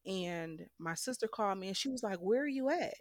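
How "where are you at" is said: concerned. Retold or imitated. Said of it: "Like, very concerned".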